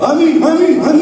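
a burst of high, loud amens from a sleepy man; suddenly fired up, amen after amen; sudden eager amen. Retold or imitated. Sleepy brother finally wakes up in church, eager high amens.

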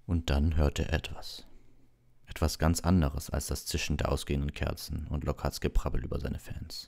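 usual speaking voice

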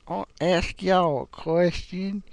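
Southern accent. Shown as a demonstration. Vlogger showing doctors his accent, hammed-up Southern accent. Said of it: "there's my Southern accent".

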